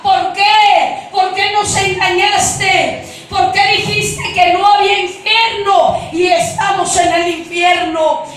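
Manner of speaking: loud, high cries of the damned; shouted accusation. Retold or imitated. Crowd in hell accusing the woman who deceived them, shouting. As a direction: demand why, at full voice. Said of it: "aquella gente gritaba".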